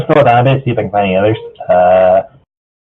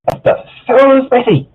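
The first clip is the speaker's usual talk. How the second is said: Pinky voice; 12 semitones higher, slower than usual. Acted. Pinky line, high and slow.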